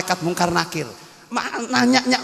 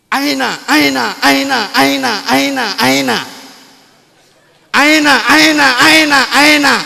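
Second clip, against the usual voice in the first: mock baby cry as aina aina; newborn crying as Arabic; 4 semitones higher, 6 dB louder, slower than usual. Newborn asks in Arabic, mock crying aina, slow then louder.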